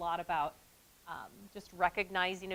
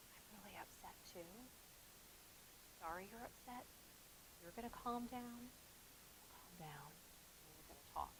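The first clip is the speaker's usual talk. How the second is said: much softer, calm soothing murmur; quieter; 24 dB quieter than usual. Retold or imitated. Teacher answering a screaming student, soft and calming. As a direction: talk her down gently and quietly. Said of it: "I got quieter".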